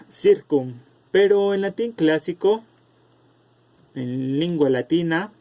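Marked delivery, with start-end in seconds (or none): 0.1-0.8 circum with an S sound at the start; soft C pronunciation. Shown as a demonstration. Teacher showing students the Vulgar Latin form, circum with soft C. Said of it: "así pasó en latín vulgar".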